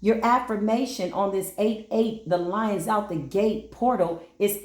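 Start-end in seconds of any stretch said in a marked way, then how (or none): none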